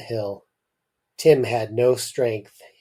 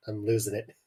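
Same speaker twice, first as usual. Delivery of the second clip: ordinary delivery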